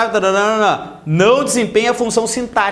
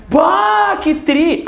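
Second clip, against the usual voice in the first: excited gaúcho bah; 9 semitones higher, much slower than usual. Retold or imitated. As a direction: burst out with a gaúcho's joy. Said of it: "Aí eu falei".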